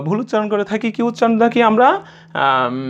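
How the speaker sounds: normal talking voice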